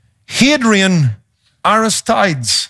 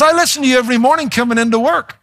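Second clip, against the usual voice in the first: higher, quick imitation of a caller; lightly voiced fan, pitched up and hurried; retold keen listener; 6 semitones higher, faster than usual. Lady tells radio host she listens every morning, higher and quick.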